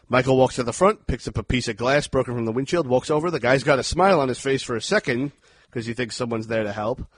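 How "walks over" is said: ordinary talk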